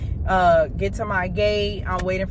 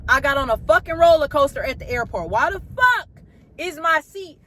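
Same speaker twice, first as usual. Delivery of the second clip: high, rising, louder disbelief; retold fury, pitched high and loud; exasperated rant; 6 semitones higher, 3 dB louder than usual. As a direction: vent at the bouncing seat. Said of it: "I'm like".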